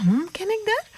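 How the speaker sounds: high, wondering hum of surprise; as if a stranger seems familiar; high puzzled wondering. Retold or imitated. Puzzled thought when greeted by stranger.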